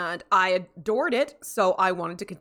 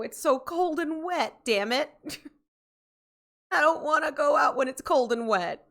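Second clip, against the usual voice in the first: high, whiny griping; whiny complaint; 7 semitones higher than usual. Real, not acted. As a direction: whine about the cold weather. Complaining about cold wet weather.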